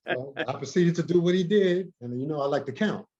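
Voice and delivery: ordinary delivery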